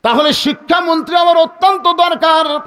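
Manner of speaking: high, loud preacher's cry; shouted, urgent plea; urgent demand. Acted. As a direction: call out the child's demand at full pitch. Child urgently demands the minister.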